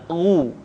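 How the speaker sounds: "ru" as throaty ghu with pursed lips; full rounded u. Shown as a demonstration. Teacher demonstrating dhammah, fully rounded "ru". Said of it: "keluar vokal u dengan sempurna".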